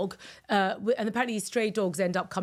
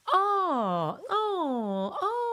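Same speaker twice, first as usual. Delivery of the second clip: higher, sustained "ah" with a crying catch; healing sound ah; 10 semitones higher, much slower than usual. Shown as a demonstration. Host leading listeners through the healing sound, ah. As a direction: sing out the heart's ah. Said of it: "for those that you want to do it right now".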